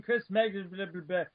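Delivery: usual speaking voice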